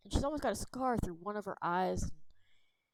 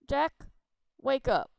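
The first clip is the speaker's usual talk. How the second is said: louder, higher wake-up call; 5 semitones higher, 7 dB louder than usual. Acted. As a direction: leader rousing sleepy Jack, louder. Waking a sleeping friend.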